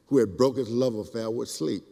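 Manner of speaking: ordinary talk, nothing marked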